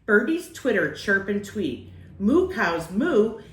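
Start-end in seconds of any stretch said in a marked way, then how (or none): none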